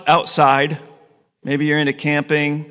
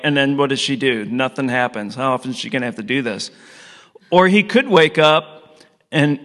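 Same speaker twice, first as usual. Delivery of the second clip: ordinary talk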